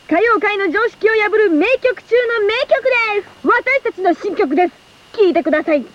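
high-pitched announcer build-up, easing at the end; hyped song plug. Acted. Idol presenting her group's new song, hyped announcer patter. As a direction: present it like a grand song introduction.

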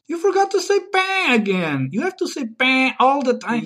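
high, insistent complaint; exasperated scolding. Acted. Guest scolds host for forgetting bang again, exasperated. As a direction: protest in a high, fed-up voice.

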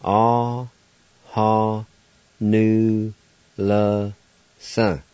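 Ah, ha, nu, le, son said one at a time; Cherokee syllables. Shown as a demonstration. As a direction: spell out the word by sound, syllable by syllable.

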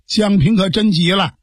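ordinary delivery, nothing marked

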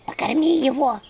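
lightly voiced imp asking; imp request. Imp Anchutka anxiously asking to feed him. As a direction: ask it worriedly as an imp. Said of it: "попросила Анчутка".

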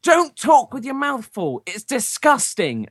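high, slow, drawn-out telling-off; mock parental scolding. Dad pretending to shout at his child, exaggerated scolding. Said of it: "Daddy's gonna shout at you".

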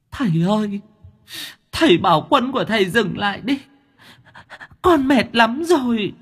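very high, wailing plea; whining sob. Acted. Exhausted ghost begging the master to call off his demon, wailing. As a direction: whimper like a beaten child begging. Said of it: "Nó ngồi nó khóc tu tu".

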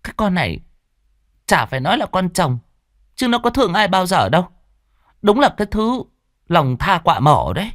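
high, sharp scornful neighbour; young neighbour sneering, lightly voiced; gossiping woman. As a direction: scoff at the stepmother. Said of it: "một cô lại lên tiếng".

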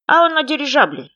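plain reading voice

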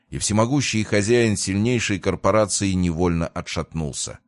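usual speaking voice

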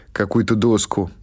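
plain reading voice

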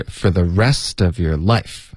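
"rest" and "life" stressed, slow. Shown as a demonstration. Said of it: "我们会把重音放在rest和life". (重读"rest"和"life"，语速放慢)

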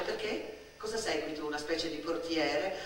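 breathy unvoiced why; whispered. Girl to shop boy, whispered why. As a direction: drop to a bare whisper.

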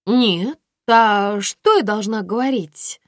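high, slow, loud puzzlement; surprised. A narrator's reading. Violetta puzzled by Aglaya's question, surprised. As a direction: ask it with drawn-out surprise. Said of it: "удивилась Виолетта".

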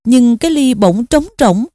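usual speaking voice

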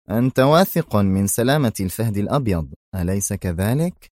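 ordinary delivery, nothing marked

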